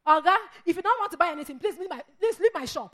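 exasperated, rushed telling-off; fed-up shopkeeper. Acted. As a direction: snap at a customer who has worn you out. Fed-up shopkeeper dismissing customer.

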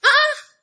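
high startled shriek; a sudden shocked cry; scandalized yelp; mock shriek.

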